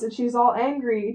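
ordinary talk, nothing marked

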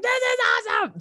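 very high-pitched fangirl squeal of delight; retold fan excitement. Fan dazzled by all the celebrities around, excited squeal. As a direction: gush in a high, starstruck voice.

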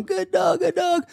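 high, cooing "Good dog"; doting praise. Retold or imitated. Owner praising his dogs, high cooing voice. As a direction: coo at the dogs lovingly. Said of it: "I'm loving on 'em".

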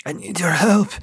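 high, strained plea for help; pained plea. A narrator's reading. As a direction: plead through the pain. Wounded man pleading.

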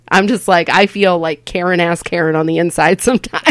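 ordinary delivery

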